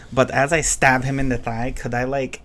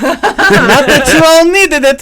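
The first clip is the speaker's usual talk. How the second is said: laughing yell; 11 semitones higher, 11 dB louder than usual. Acted. Player teasing the stabbed companions, laughing yell. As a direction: keep shouting through amusement.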